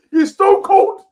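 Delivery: loud, high hype; emphatic. Real, not acted. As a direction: hype it up loudly.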